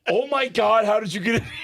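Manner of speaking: high, loud mock astonishment; amazed disbelief. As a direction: imitate her astonished squeal at seeing you. Retold girl amazed to see him.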